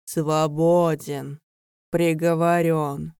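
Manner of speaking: slow, heavy, hollow pronouncement; slow doom voice. Acted. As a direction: intone it like a death sentence.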